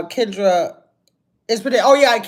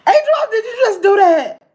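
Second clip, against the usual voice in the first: laughing disbelief; 9 semitones higher, 4 dB louder than usual. Real, not acted. Caught out by a viewer.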